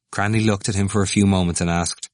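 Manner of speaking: ordinary delivery, nothing marked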